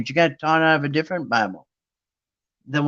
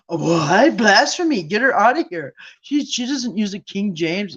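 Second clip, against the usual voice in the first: high-pitched, scandalized mock gasping; mock outrage; 5 semitones higher than usual. Churchgoer denouncing a woman with another Bible, mock outrage.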